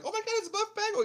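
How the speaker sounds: high-pitched mock fan squeal; hammed-up groupie voice, way up high; gushing gym rats. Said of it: "Those gym rats are like".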